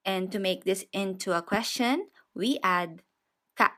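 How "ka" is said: normal talking voice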